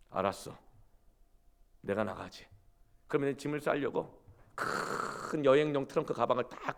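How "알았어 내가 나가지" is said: lower, soft, giving in; resigned husband. Retold or imitated. Husband backing down to his wife, soft resignation. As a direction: quietly concede the fight. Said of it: "이 남편이 이렇게 말합니다".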